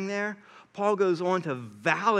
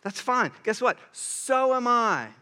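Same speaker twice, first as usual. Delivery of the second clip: smug retort; 6 semitones higher than usual. Retold or imitated.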